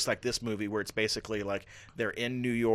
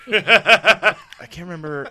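laughing through the words; high, slow laughing reply; 8 semitones higher, 14 dB louder, slower than usual. Real, not acted. Laughing while answering friends.

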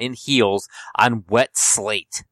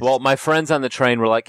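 ordinary talk

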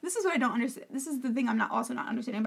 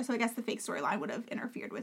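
whispered aside; 8 dB quieter than usual. Real, not acted. Snarky aside about fake storyline.

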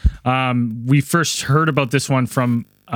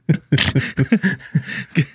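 laughing. Real, not acted. Laughs at own failed accent.

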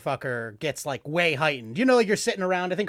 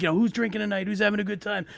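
big hype comic; 6 semitones higher than usual. Retold or imitated. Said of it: "try to be like".

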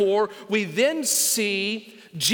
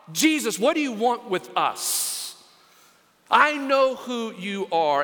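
ordinary delivery

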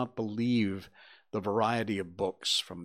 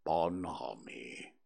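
very low, slow and soft donkey; gloomy; 8 semitones lower, 9 dB quieter, slower than usual. A narrator's reading. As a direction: sigh it out like a sad old donkey. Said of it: "went on Eeyore gloomily".

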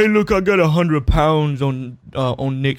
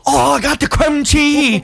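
mock voice; 11 semitones higher, 5 dB louder, slower than usual. Mock accent bit on cream cheese.

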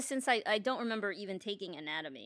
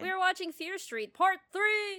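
high excited announcement; 9 semitones higher, 4 dB louder than usual. Real, not acted.

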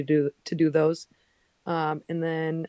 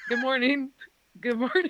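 lightly acted higher-pitched good morning; mock cheery greeting; 6 semitones higher than usual. Skydiver with parachute greeting school kids, mock cheery. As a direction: play a sheepish hello to passersby. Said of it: "walking around with our parachutes, like".

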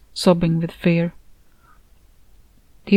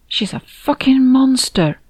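slow, higher hiss through teeth; hissed through clenched teeth; 7 semitones higher, 3 dB louder than usual. A narrator's reading. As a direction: spit it out slowly, teeth clenched. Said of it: "I hissed between clenched teeth".

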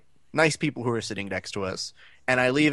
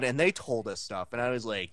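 ordinary delivery

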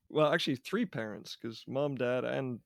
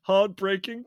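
ordinary delivery

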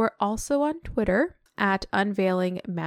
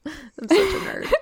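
laughing; 10 semitones higher than usual. Real, not acted. Laughing at herself.

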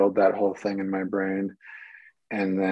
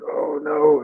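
breathy, loud "oh no" in dismay; dismayed gasp; 6 semitones higher, 7 dB louder than usual. Retold or imitated. Actor watching the phone play wrong scenes, panicked whisper-gasp.

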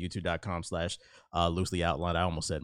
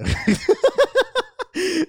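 high burst of laughter; 8 dB louder than usual. Real, not acted. Laughs at own sexy voice.

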